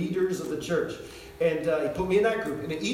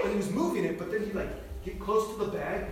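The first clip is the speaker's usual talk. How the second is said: ordinary talk, nothing marked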